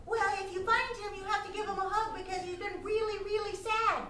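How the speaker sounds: high-pitched small rabbit voice; sympathetic little rabbit. Acted. Rabbit asking detective to hug wolf, high sympathetic voice.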